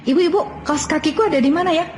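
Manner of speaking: put-on high, fast little-kid voice; child voice. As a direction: chirp it like a small child asking mom.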